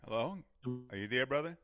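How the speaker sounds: phone line cutting out; muffled call breaking up; bad connection. Real, not acted. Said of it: "you're breaking up on me".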